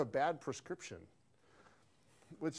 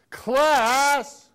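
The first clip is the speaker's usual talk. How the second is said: high, loud call to the class; 11 semitones higher, 17 dB louder than usual. Shown as a demonstration. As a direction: sing out the cue word to the room. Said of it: "If I say".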